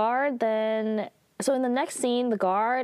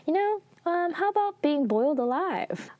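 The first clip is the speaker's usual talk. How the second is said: much higher, playful mock suggestion; joking tease; 10 semitones higher than usual. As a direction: tease her with a sing-song joke.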